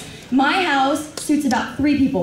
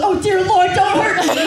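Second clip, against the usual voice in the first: high, pleading critter voice; hammed-up squirrel begging for mercy; frightened squirrel; 7 semitones higher, 3 dB louder than usual. Squirrel fleeing from angry girl, high mock pleading.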